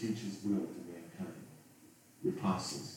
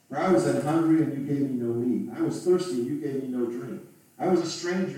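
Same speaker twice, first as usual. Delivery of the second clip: rising, ringing louder and higher; preaching at full force; 4 semitones higher, 7 dB louder than usual. Acted. Preacher voicing Jesus rebuking the wicked, forceful and loud. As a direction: thunder the charges at the crowd.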